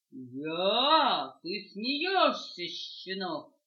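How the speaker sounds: loud, slow scornful dog; big dog scoffing at the puppy, loud. Acted.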